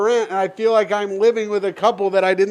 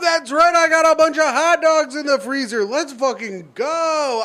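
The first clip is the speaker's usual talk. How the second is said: excited; 9 semitones higher than usual. Real, not acted. Hyped about frozen hot dogs.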